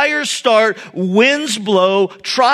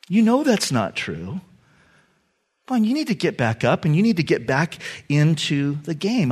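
gentle voice of God; 4 semitones lower, 5 dB quieter than usual. Acted. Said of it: "And God's going".

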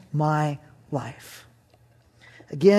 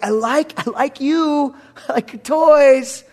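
put-on higher, slow childish voice with a laugh; kid's voice; 6 semitones higher, 5 dB louder, slower than usual. Little boy telling the dog he likes it, childish. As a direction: play the awkward little boy.

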